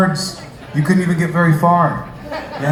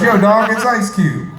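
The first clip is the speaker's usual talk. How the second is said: slow, higher mock [name] drawl; imitating [name]; 8 semitones higher, slower than usual. [name] calling a sleepy comic, slow mock voice. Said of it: "He goes".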